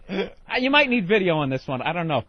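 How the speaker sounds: ordinary talk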